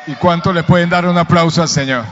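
loud ringing shout to the crowd; rousing call to applaud. Real, not acted. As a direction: call out and lift the whole room. Pastor calls congregation to applaud.

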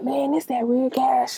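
whispered reply. Retold or imitated. Friend's reply acted out.

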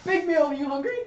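high, loud barkeep offer; hammed-up broken-English hostess; barkeep Holga. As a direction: push food on a guest, loudly.

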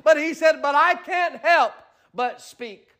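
climbing, high, full-force preaching; rising preacher cadence. Acted. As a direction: build the sermon to a peak. Preaching quote of Peter.

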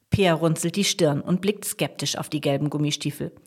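ordinary delivery, nothing marked

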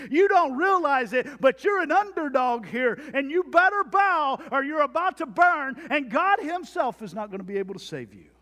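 loud, high, taunting arrogance; arrogant king. Acted. Preacher voicing the king taunting the three, arrogant. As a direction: sneer down at the captives like a tyrant. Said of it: "Do you hear the arrogance?".

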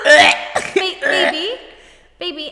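high, mocking American "baby"; mock it in an American accent. Taking the piss out of the pet name, American accent. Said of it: "in American accent, taking the piss".

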